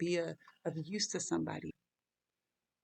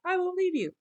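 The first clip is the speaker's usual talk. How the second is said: higher and louder, an insistent promise; mock disciple vow; 5 dB louder than usual. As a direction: repeat it louder, high, insisting. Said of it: "To just kinda be like".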